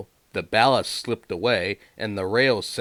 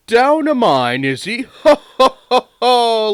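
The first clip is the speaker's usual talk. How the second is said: high, loud mocking laughter; laughing at him; 12 dB louder than usual. A narrator's reading. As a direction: laugh at the trapped engine. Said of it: "laughed Gordon".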